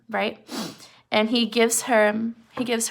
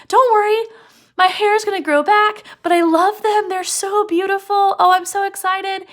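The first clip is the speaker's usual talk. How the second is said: much higher, louder delighted gushing; high, loud, thrilled young wife opening her gift; excited wife; 9 semitones higher, 7 dB louder than usual. Retold or imitated. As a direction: squeal over the beautiful combs. Wife voiced receiving combs.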